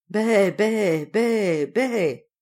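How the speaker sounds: even, drawn-out bleats; imitated goat bleat. Goat bleats after its answer.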